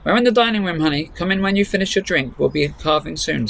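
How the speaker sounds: mother calling her daughter to dinner; cheery mum. Acted. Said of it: "said Mum".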